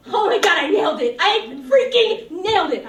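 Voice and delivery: loud, high squeal of triumph; thrilled shout. Real, not acted. Girl cheering herself after singing on camera, loud and thrilled. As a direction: yell it like you just aced it.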